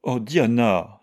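aggrieved wail, lightly acted; moaning. A narrator's reading. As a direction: exclaim in pained reproach. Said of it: "gémit Georgie".

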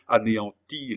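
usual speaking voice

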